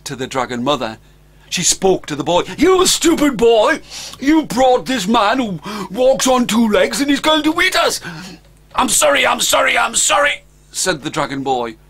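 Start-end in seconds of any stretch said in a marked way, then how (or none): 2.6-8.0 high, loud, furious scolding; a mother dragon berating her boy. Acted. 8.7-10.4 higher, louder pleading sorry; a scolded child dragon; apologetic dragon boy. Acted.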